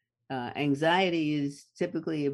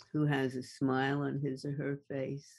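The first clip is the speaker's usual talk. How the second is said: ordinary talk, nothing marked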